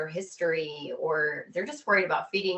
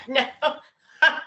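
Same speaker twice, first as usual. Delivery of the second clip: imitating a friend's refusal through laughter, higher and a little louder; laughing mock quote; 6 semitones higher, 4 dB louder than usual. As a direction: quote him while still laughing. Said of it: "He said".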